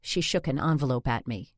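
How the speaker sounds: ordinary delivery, nothing marked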